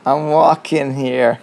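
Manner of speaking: slow, loud, hammed-up New York accent; a lightly done New Yorker yelling at a car. Imitating a New Yorker for his co-host, slow loud New York accent. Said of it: "I'm not gonna try my American accent".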